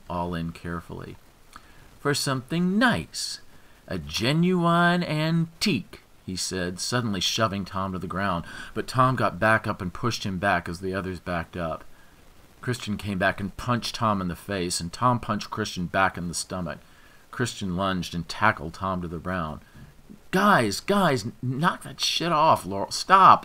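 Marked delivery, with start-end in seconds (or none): 2.0-6.0 slow mock. A narrator's reading. 20.3-23.5 high, loud urgent pleading; frantic plea to stop. A narrator's reading. Laurel shouting at the brawling men, frantic.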